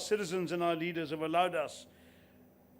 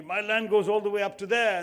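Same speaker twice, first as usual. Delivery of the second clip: louder, puffed-up pride in his land; proud boast; 3 semitones higher, 6 dB louder than usual. Retold or imitated. Indian farmer boasting proudly to his American visitor. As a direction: boast like a proud host showing off. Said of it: "said very proudly".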